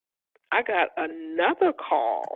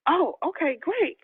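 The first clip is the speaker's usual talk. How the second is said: mock eager; 9 semitones higher than usual. Retold forced enthusiasm on phone.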